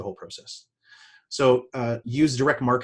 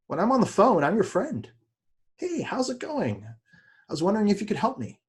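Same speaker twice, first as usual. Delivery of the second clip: bright, much higher, slowed-down friendly caller; friendly phone voice; 8 semitones higher than usual. Shown as a demonstration. Salesperson demonstrating a cold call, light and friendly. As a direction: sound like their new friend on the phone. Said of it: "if you notice the tonality of the voice".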